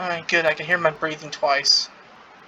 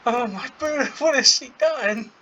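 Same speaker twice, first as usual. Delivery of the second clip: aghast, voice jumping up high; shocked; 7 semitones higher, 3 dB louder than usual. Real, not acted. Laughing dismay at betrayal.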